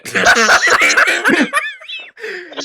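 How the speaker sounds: shrieking burst of laughter; loud high laugh. Real, not acted. Chaotic moment on stream.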